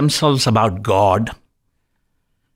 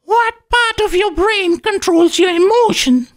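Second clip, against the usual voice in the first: much higher, lightly voiced nurse; nurse's voice. Nurse asks the doctor about emotions, high-voiced. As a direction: pitch it up as the nurse asking. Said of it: "asked my nurse".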